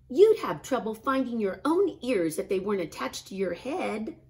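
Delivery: big sister Ruby. A narrator's reading. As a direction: rib him like a bossy older sister. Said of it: "said Ruby".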